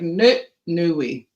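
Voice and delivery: slow, drawn-out flat no; a mock-defiant, dragged-out refusal; stubborn refusal. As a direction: dig in your heels, slowly. Refusing the Holy Spirit's prompt.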